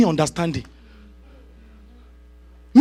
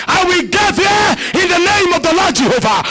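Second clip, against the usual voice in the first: repeated shouted declaration; 8 semitones higher than usual. Acted. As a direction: hammer the same vow home.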